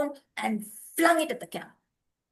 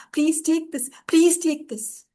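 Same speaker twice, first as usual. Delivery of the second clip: higher, slow begging; pleading offer; 5 semitones higher, 5 dB louder, slower than usual. A narrator's reading. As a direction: press the money on them.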